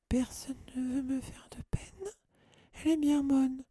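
very high, tearful wail; lamenting. A narrator's reading. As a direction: throw it back as a sobbing lament. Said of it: "se lamenta Mimi". (lamentation suraiguë et larmoyante)

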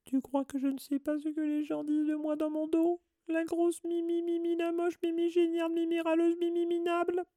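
very high, squeaky tearful tirade; hammed-up self-pitying wail; wailing Mimi. A narrator's reading. Ghost Mimi lists the names people call her, wailing. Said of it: "se mit à pleurer à chaudes larmes".

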